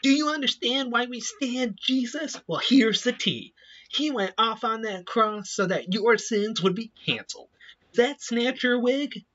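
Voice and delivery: put-on high, energetic kids' TV host voice; perky adult hosting a children's show; upbeat youth pastor. Shown as a demonstration. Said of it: "higher pitched, more energetic, like adults doing children's T V show".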